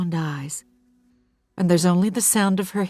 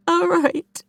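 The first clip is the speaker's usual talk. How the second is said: woman's voice; 13 semitones higher than usual. Acted. Diane agrees, voiced.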